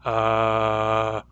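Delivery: a low, steady voiced hum on Uh; vibrating vocal cords. Shown as a demonstration. Teacher demonstrating voicing to the class, low steady hum. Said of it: "it sounds like this".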